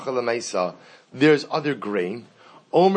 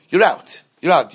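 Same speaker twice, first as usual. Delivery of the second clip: louder, punchy and final; emphatic loud; 3 semitones higher, 8 dB louder than usual. Shown as a demonstration. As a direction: slam the door shut on it. Teacher hammers home missing deadline.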